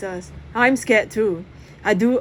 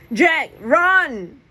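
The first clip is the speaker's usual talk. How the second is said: loud urgent yell; shout; 7 semitones higher, 6 dB louder than usual. Acted. Press yelling at Jack to flee the fire, loud. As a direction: warn him to run. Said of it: "Press shouts".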